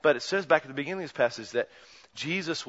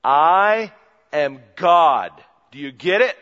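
much louder, I am God punched; loud declaration; 13 dB louder, slower than usual. Acted. Jesus declaring himself to the crowd, loud and blunt. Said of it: "in our language would have said".

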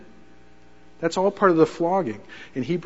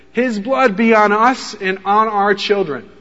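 slow, louder, weighty; crowd's solemn cry; 4 semitones higher, 6 dB louder, slower than usual. Retold or imitated. People taking the blame before Pilate, slow loud cry. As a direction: lean on blood and draw it out.

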